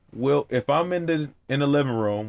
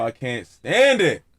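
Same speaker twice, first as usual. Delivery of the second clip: ordinary delivery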